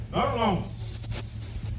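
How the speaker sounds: long drawn-out hiss, whispered; snake hiss. Shown as a demonstration. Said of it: "they hiss like a snake".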